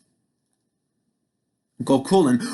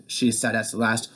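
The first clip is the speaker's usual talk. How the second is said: ordinary delivery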